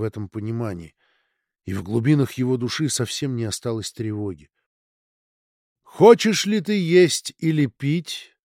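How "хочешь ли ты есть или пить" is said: high, slow, ringing voice from above; crystal voice. Acted. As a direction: ring out like crystal overhead. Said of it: "раздался хрустальный голос".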